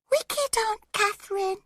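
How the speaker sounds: put-on a toddler whimpering through tears; sobbing child. A narrator's reading. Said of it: "started sobbing and saying".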